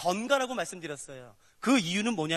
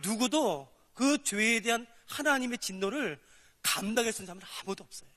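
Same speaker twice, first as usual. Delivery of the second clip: ordinary delivery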